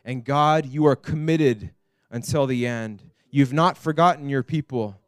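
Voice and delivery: fervent spoken prayer to God; earnest prayer. Acted. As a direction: pray aloud for everyone, heartfelt and steady. Pastor prays over the congregation.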